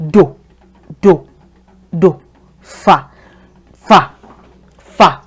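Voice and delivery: "Do" and "fa" each said low and falling; low tone drill. Shown as a demonstration. Teacher repeating low-tone syllables for practice. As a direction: keep every syllable low. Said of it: "That's how to say it".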